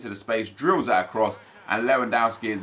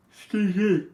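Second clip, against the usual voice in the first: yawning; 8 semitones higher, slower than usual. Real, not acted. Streamer apologising to chat while yawning through it. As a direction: excuse me mid-yawn, a touch higher. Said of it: "because I yawned".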